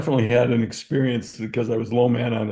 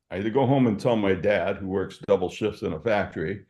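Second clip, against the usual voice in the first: usual speaking voice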